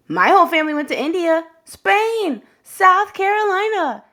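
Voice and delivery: eager little classmate boasting; bragging kid. Acted. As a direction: rattle off places like a showing-off kid. Classmate lists travels.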